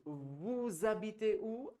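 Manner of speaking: "Vous habitez où" drawn out slowly; slow formal question. Shown as a demonstration. Teacher models the formal question slowly. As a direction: stretch each syllable for the class. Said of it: "Very good".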